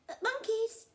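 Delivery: high, loud holler; calling out. Acted. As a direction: summon the monkeys from afar. Crocodile calling out to monkeys.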